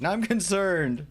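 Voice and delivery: drawn-out half-joking yell; mock-screamed concern. Real, not acted. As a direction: yell it as fake alarm. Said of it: "I don't know why I am screaming".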